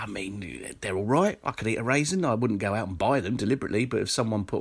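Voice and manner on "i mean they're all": breathy half-voiced aside; whispered aside. Real, not acted. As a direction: drop to a whisper for a second. Quiet aside while musing.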